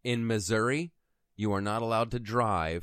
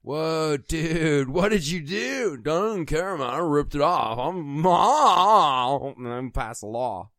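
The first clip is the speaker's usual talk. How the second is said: stoner voice; 4 semitones higher, 7 dB louder, slower than usual. Acted. Stoned guys ripping mattress tags.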